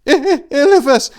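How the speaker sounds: loud, high, broken stammer; terrified stutter. A narrator's reading. Ameneth stuttering to Tor, terrified. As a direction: stumble over the words in shock. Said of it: "he stuttered".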